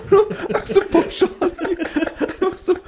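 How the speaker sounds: high, laughing words; giggling through the complaint. Real, not acted. Laughing while complaining about friend.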